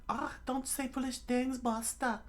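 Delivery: very high squeaky scolding; high cartoon woman telling someone off; mock scream. A narrator's reading. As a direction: scold in a thin falsetto, no real scream. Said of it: "she screamed out".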